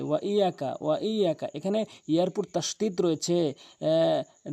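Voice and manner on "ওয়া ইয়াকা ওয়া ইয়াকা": quick ওয়া ইয়াকা with single y; ওয়া ইয়াকা short, no stretch; common mispronunciation. Shown as a demonstration. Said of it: "আরেকটি ভুল করে".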